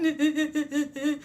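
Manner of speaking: high, put-on sobbing noise; mock ugly crying. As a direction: imitate wordless blubbering. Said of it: "ugly crying and stuff".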